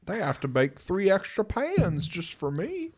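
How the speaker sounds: high, loud, slow goofy voice. Retold or imitated. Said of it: "I was like".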